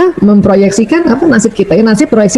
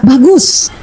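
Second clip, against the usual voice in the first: "Bagus" lifted with a rise; upbeat "Bagus"; 2 semitones higher than usual. Shown as a demonstration. Mother answers about her kids, upbeat and bright. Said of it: "bisa lebih tinggi lagi".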